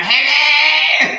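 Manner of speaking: a granny's hello; old lady voice. Pretend. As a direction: croak it out like an elderly neighbour. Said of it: "say hello like that old lady".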